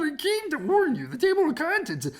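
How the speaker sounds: breathless narrator; urgent warning. Acted. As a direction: hurry to warn them. Narrator warns the hen.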